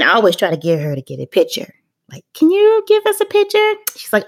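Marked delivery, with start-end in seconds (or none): 2.3-3.7 high sweet coaxing ask; pleading ask. Retold or imitated. Mom coaxing grandmother for a photo, high and sweet.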